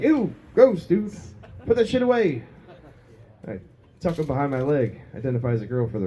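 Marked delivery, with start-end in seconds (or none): 0.0-2.4 high, squeamish disgust. Retold or imitated. Comic telling himself in the shower, grossed out. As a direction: recoil like you saw something gross.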